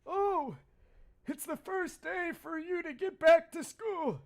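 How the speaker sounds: put-on cheerful old neighbour greeting; friendly neighbour uncle. Voices uncle's lines.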